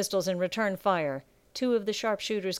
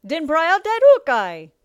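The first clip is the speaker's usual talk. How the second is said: high, loud roar that drops on the last word; urgent sea captain's bellow; captain's shouted order; 9 semitones higher, 10 dB louder than usual. A narrator's reading. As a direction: bellow the command to the crew. Captain answers his crewman's call.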